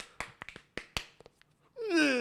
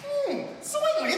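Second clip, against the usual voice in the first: Curly soitenly; much slower than usual. Acted. Curly character promo.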